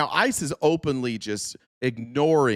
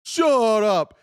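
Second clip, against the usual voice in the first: mock officer voice; 10 semitones higher, faster than usual. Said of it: "And he's like".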